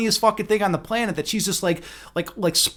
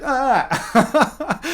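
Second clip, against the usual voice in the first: high mock groan breaking into laughter; exaggerated high "ah" at the horn; annoyed drunken wail; 6 semitones higher than usual. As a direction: imitate her protesting yelp, amused. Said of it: "every time I did the horn".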